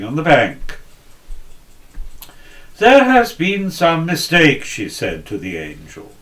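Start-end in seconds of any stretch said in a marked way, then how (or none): 2.8-4.6 put-on indignant old lady. Old woman protests to angel.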